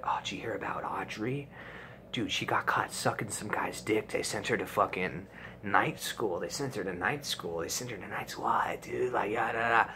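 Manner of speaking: soft hushed rumor voice; gossip whisper. Retold or imitated. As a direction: pass the rumor on under your breath.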